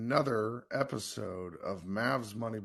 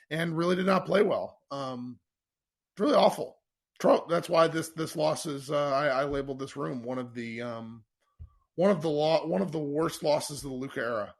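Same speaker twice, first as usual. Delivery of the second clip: louder, higher frustrated rant; 4 semitones higher, 7 dB louder than usual. Real, not acted. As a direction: vent the loss, louder. Host ranting about awful loss.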